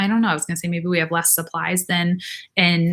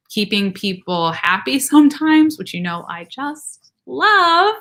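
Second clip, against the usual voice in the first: drawn-out sarcastic gush; sarcastic, slow and higher on love; 6 semitones higher, 3 dB louder, slower than usual. Real, not acted.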